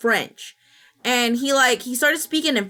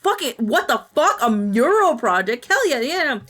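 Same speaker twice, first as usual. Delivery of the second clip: high-pitched, thrilled outburst; real excitement; 6 semitones higher, 4 dB louder than usual. Real, not acted. Streamer thrilled about a mural project, excited. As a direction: blurt out delighted amazement.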